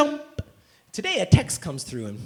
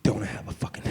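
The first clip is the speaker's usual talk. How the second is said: hissed under breath, much softer; seething whisper; 8 semitones lower, 6 dB quieter, faster than usual. Acted. Furious at his inbox, in a seething whisper. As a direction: whisper it through rising rage.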